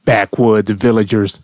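ordinary talk

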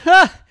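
one loud high scornful "Ha!"; mocking laugh. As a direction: bark a laugh at him. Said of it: "And the iguana just laughed".

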